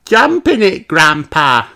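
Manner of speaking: put-on high eager little girl voice; excited cry. A narrator's reading. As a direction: urge Grandpa on, loud and high. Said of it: "cries Peppa".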